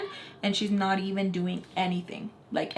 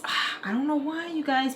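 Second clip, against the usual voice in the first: ordinary delivery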